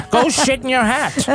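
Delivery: high 1940s movie voice with a laugh; sling it like a forties gangster; old-timey insults. Pretend. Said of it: "Old-timey insults".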